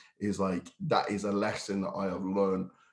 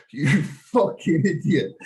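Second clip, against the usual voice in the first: laughing self-scold; 7 semitones higher, 4 dB louder, slower than usual. Retold or imitated. Scolding himself, retold.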